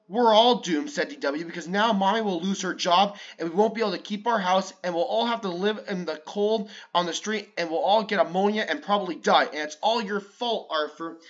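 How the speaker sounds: little sister panics and blames Arthur; dramatic panic. Acted.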